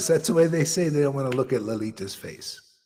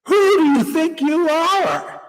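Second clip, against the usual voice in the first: much higher, louder offended snap; mock indignant student; 12 semitones higher, 6 dB louder, slower than usual. Guru imitating a disciple turning on him, high mock outrage. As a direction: bristle like an insulted disciple. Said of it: "And she said".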